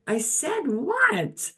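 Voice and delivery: very high, slow I said what; disbelieving. Retold or imitated. Donna hearing she said it eleven times, disbelieving. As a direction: stretch out the shocked what. Retelling her own disbelief.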